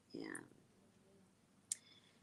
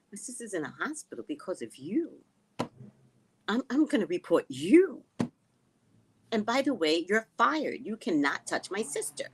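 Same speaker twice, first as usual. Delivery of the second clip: higher, punched words in a retold telling-off; acted-out indignant retort; retold indignant confrontation; 6 semitones higher, 3 dB louder than usual. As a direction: replay firing the doctor, hitting YOU and FIRED. Retells standing up to doctor.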